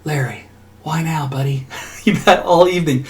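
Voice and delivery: a gently fed-up owner asking why now; exasperated to the dog. Retold or imitated. Owner to his yappy dog at night, mock exasperated.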